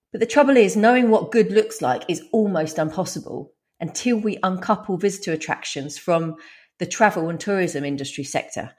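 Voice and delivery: normal talking voice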